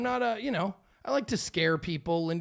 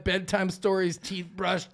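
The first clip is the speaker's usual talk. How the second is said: ordinary talk, nothing marked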